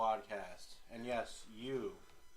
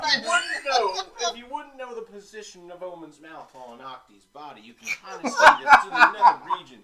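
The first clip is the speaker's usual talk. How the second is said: put-on booming old-time radio voice, laughing; hammy vintage newscaster bit; 1930s radio announcer; 8 semitones higher, 20 dB louder than usual. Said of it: "nineteen thirties radio announcer".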